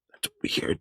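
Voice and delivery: unvoiced whisper to yourself; hushed puzzled whisper; whispered aside. Player puzzled, muttered aside.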